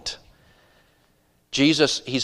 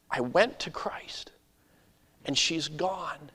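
slow, soft, half-whispered disbelief; stunned grief; 6 dB quieter, slower than usual. Acted. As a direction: let the loss sink in slowly and quietly. Jairus absorbing his daughter's death.

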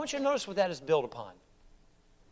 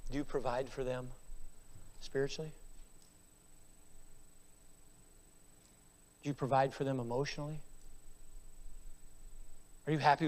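low, soft searching questions; quiet, probing preaching; 5 semitones lower, 11 dB quieter, faster than usual. Real, not acted. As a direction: question them gently but gravely. Quiet probing answer to fathers.

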